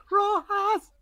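Rojas squeaked up very high; high-pitched name call. Acted. Shouted character's name, very high.

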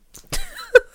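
sharp high laugh; laughing at being played; high squeal of laughter. Real, not acted. Laugh after getting played.

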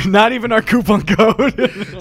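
words pushed out through a high laugh; slow, cracking up mid-line; giggling through the joke. Real, not acted. Laughing at their own joke.

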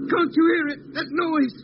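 frightened urgent call to Doc; alarmed. Acted. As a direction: cry out in sudden fright. Lemmy alarmed by the noise.

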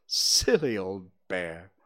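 affectionate, gentle teasing; loving. A narrator's reading. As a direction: say it fondly, taking your time. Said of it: "in such a loving voice".

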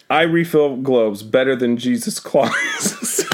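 reading through suppressed laughter; trying not to laugh while reading; stifled giggles. Real, not acted. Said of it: "I'm trying not to laugh".

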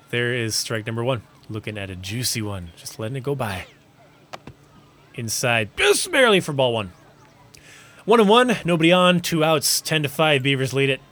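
lively baseball announcer call; sports play-by-play. Acted. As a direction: call the game like a broadcaster.

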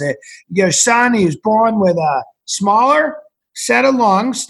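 lightly imitating a Boston doctor; Boston doctor accent. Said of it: "he talked with a Boston-y kind of accent".

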